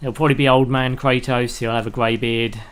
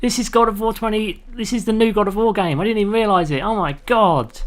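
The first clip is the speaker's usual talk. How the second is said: higher, louder, thrilled gamer; excited; 9 semitones higher, 4 dB louder than usual. Retold or imitated. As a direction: gasp it out like a shocked fan. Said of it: "You're like".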